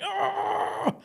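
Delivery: high yelp of alarm; a quick high gasp-cry; mock startled cry. Barrelman reacting to getting hooked, high startled cry. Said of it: "I'm like".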